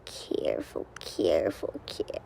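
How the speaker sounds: breathy whispered warning. Real, not acted. Kid gaming alone whispers a warning, unvoiced. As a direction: whisper careful to yourself. Sneaking past danger in game.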